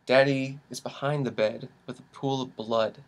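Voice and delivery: soft, somber narration; hushed grim storytelling. Acted. Host painting a grim morning for co-host, hushed and solemn. Grim scenario told as dark comedy.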